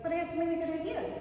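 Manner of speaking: high, quick little objection; meek protest. Acted. Employee told not to talk today, meekly protesting. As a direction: plead your case in a small high voice.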